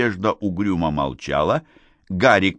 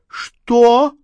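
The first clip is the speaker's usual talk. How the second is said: high, loud cry of disbelief; shouted "what"; 8 dB louder than usual. A narrator's reading. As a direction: cry out sharply in shock. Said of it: "закричала Надежда". (возмущённый крик)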